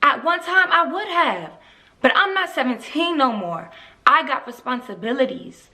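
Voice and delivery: put-on indignant young mother firing practical questions; Rena. Rena confronting Darnell about the surprise house, exasperated. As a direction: challenge him, pitch jumping on the key complaints.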